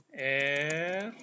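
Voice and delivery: ordinary talk, nothing marked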